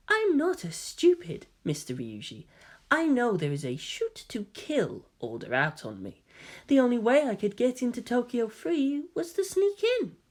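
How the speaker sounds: high, soft and poised; a lightly mocking lady voice; genteel high voice, calm and sly; dainty feminine voice. A narrator's reading. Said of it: "Karu held up a dainty hand".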